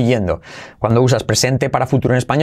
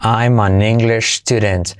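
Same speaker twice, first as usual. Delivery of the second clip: slow model; 2 semitones lower, slower than usual. Shown as a demonstration.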